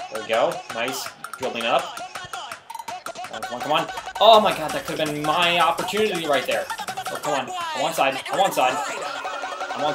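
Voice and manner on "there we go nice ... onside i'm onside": ordinary talk, nothing marked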